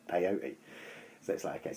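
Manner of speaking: ordinary delivery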